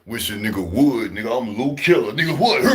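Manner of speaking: mock tough guy. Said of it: "I didn't walk in jail like".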